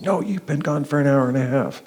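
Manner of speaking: high, insistent mock yell; retold scream. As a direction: imitate an exasperated wife contradicting you. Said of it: "she screams at me".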